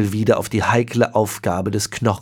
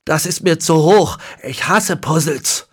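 higher, louder whiny complaint; tormented groan; 7 semitones higher, 4 dB louder than usual. A narrator's reading. Grandson groaning to his great-grandfather, whiny and loud. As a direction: groan it out like a sulky teen. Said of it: "stöhnte er gequält auf und rief".

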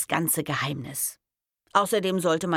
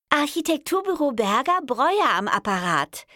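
high, louder friendly phone greeting; 10 semitones higher, 5 dB louder than usual. A narrator's reading. Intern repeating her phone greeting, friendly high voice. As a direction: sing out a friendly office greeting, pitched high. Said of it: "wiederholte die Stimme freundlich".

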